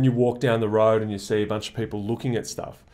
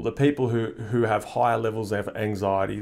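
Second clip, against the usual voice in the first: ordinary talk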